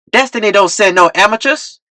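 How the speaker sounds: high mocking yell. A narrator's reading. Pimp in the crowd yelling at T-Real, mocking. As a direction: shout him down. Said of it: "Someone yelled".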